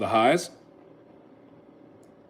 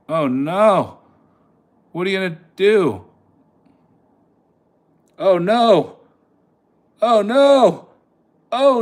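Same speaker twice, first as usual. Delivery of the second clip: higher and louder with each oh no; real panic climbing to a near shriek; building alarm, much higher and louder; rising panic; 9 semitones higher, 7 dB louder, slower than usual. Real, not acted. Price dropping live.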